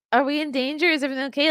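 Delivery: high, anxious questions; worried baby. Acted. Jolted baby looking to parent, high worried questioning. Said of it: "he looked at me like".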